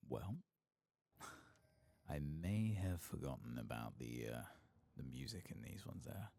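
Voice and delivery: normal talking voice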